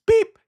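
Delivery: high, loud mouth beep; censor beep. Pretend. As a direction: blurt a bleep sound.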